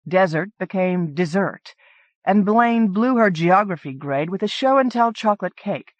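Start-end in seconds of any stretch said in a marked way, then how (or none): none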